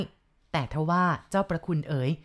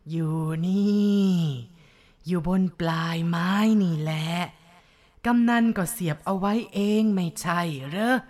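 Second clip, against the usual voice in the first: ghost voice. Acted. Severed ghost head reminding the chief he staked it, eerie wail. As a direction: accuse him like a wailing ghost.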